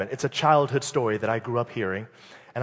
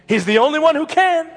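high, loud proclamation; preaching shout; 9 dB louder than usual. Acted. Preacher to congregation about Jesus, shouted high declaration. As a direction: declare it to the rafters.

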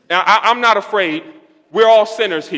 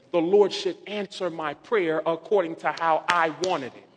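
normal talking voice

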